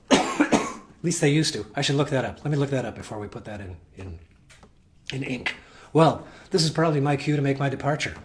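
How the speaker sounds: throat tickle, coughing between words; talking past a swallowed bug; coughing through words. Real, not acted. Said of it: "I think I swallowed a bug".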